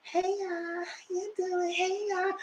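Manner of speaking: tiny, very high soft voice; Mel talking low. Retold or imitated. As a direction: imitate a whispery, high little voice. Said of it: "But she mostly talk low".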